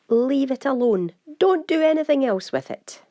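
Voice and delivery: emphatic classroom caution; firm warning. Real, not acted. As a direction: warn them off sternly, pushing the voice up. Teacher warns against further simplifying.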